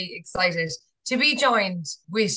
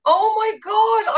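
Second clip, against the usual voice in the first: excited; 12 semitones higher, 5 dB louder, slower than usual. Real, not acted. Reacts to the [name] answer.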